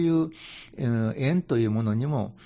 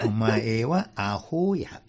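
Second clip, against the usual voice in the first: very slow, drawn-out mock comic line; hammed-up comedian delivery; comedy catchphrase; 3 semitones lower, much slower than usual. Monk quoting the Hot Brothers' gag: very slow comic catchphrase. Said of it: "なんかのありましたよね、昔".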